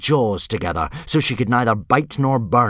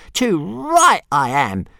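higher, slow, grumpy little dragon; 8 semitones higher, 4 dB louder, slower than usual. Acted. Toothless answering Hiccup's praise, grumpy and slow. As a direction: grumble it out like a sulky dragon. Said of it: "said Toothless grumpily".

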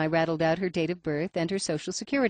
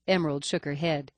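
ordinary delivery

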